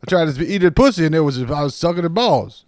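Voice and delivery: high, very loud comic storytelling impression; Eddie Murphy. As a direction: shout the bit like a brash stand-up. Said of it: "And then Eddie Murphy".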